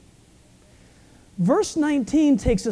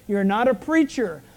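usual speaking voice

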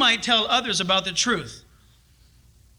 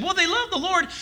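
lightly mimicked excuse, pitched higher; believer's naive excuse; 7 semitones higher than usual. Believer defending three friends' advice, lightly mimicked. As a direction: offer a higher-pitched, mock-earnest defence.

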